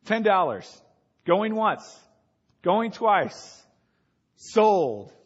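loud auctioneer calling bids. Retold or imitated. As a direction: call it like an auctioneer.

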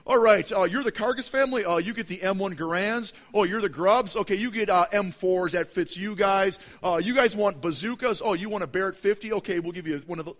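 hammed-up jovial quartermaster; lightly voiced Pharaoh joking; mock Pharaoh handing out weapons. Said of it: "No, it wasn't like that".

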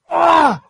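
loud, high raging yell; angry scream. Retold or imitated. Man screams alone on empty street, raging yell. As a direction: let out a wordless scream of rage. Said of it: "I was screaming".

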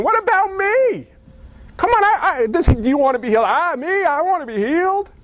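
high-pitched pleading to be picked; desperate plea. Acted. An overlooked invalid at the pool calling to Jesus, hammed-up high plea.

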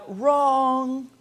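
mock buzzer verdict; a hint of game show host. Pastor playing game host rejecting the objection, mock verdict. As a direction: pronounce it like a quiz host. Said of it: "game show buzzer".

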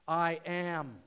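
a loud, ringing declaration; proclaimed. Acted. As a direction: announce it with full weight. Jesus' climactic claim.

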